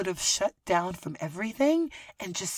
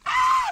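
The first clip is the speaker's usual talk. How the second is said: high scream; 10 dB louder than usual. Real, not acted. Outraged realization.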